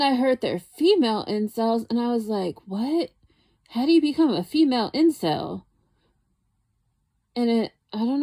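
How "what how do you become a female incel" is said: baffled disbelief, higher pitch; incredulous. Retold or imitated. Retelling first reaction to female incels, incredulous. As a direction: ask it louder and higher, as if stunned.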